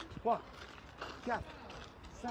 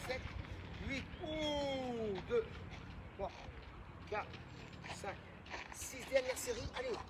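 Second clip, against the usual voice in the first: counting workout reps; 4 semitones higher, 7 dB quieter, slower than usual. Real, not acted.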